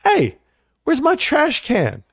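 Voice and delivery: hammed-up baffled cry at the empty curb; mock disbelief. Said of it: "I said".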